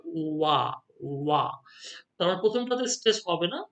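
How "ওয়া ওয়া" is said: "ওয়া" with a light first sound, stress landing on the second; iambic stress. Shown as a demonstration. Teacher showing students the iambic beat, "ওয়া" stressed on its second part. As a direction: say "ওয়া" as an unstressed-then-stressed beat, twice. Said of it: "পরেরটাতে stress হবে".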